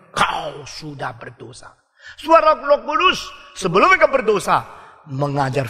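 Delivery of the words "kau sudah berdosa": slow, softer reproach from the conscience; quiet conscience. Retold or imitated. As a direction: let the conscience murmur it slowly. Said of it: "hati nurani".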